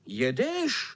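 put-on kids' mild angry yell; children calling out. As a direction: call the question up high. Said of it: "tak najednou vykřikli".

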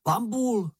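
high-pitched excited call; high young character's cry; exclaiming. A narrator's reading. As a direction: call out high, without much extra volume. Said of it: "vykřikl Mumínek".

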